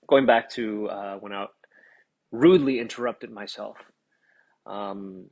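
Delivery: ordinary delivery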